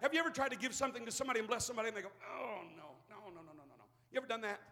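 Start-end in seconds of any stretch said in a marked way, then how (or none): 2.2-3.8 much softer, lower demurring no's; mock polite refusal. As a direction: wave off the gift shyly.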